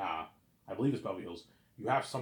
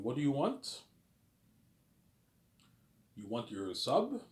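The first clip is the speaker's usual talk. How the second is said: imitating a Quebec French accent in English; a light put-on French-Canadian accent; 5 semitones higher, slower than usual. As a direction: mimic a French speaker asking about a sub, slower at the end. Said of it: "I'm saying their French accent".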